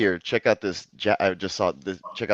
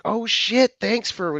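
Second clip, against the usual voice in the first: high, thrilled thank you; overjoyed at the gift; excited gratitude; 12 semitones higher, much slower than usual. Retold or imitated. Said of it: "I was like".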